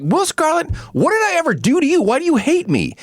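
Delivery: high, anguished plea. Retold or imitated. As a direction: cry out why he is hated. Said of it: "He's like".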